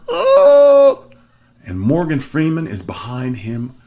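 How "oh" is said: very high, loud "oh" held out; sudden loud high yelp, imitating a movie character; mock cry out. Evan's plans get cut off.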